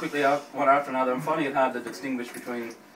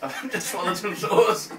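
laughing; 6 semitones higher, slower than usual. Real, not acted. Laughing after swallowing hot sauce.

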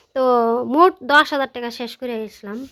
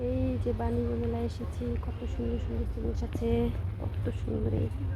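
much softer, nearly a whisper at the end; speaking softly; 9 dB quieter than usual. Real, not acted. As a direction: keep your voice down in the crowded fair. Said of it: "তো এখন আস্তে আস্তে কথা বলতেছি".

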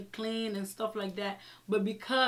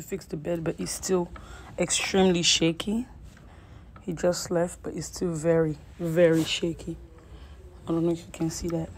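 exasperated; 4 semitones lower, 5 dB louder than usual. Real, not acted. Filming the wobbly bed.

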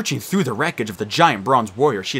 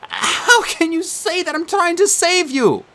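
put-on higher, louder desperate boy's plea; crying out; 3 dB louder, slower than usual. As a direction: beg his sister for an answer. Nico's voice.

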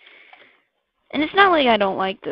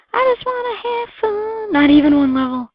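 mock whine; 11 semitones higher, 6 dB louder than usual. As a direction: whine it out in a silly high voice. Whining in a put-on voice.

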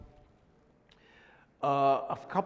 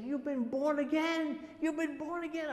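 imitating thrilled insistence, pitch way up; excited; 13 semitones higher than usual. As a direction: act out a friend's elated announcement. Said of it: "He was so excited".

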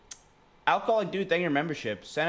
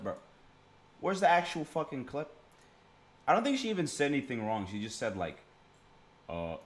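ordinary talk, nothing marked

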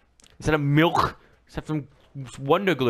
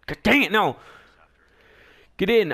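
usual speaking voice

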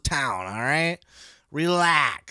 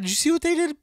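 ordinary talk, nothing marked

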